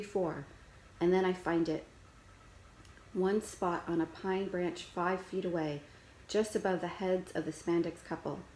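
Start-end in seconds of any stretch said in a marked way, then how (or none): none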